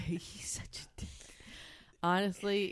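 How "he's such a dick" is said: whispered aside. Real, not acted. Whispered insult.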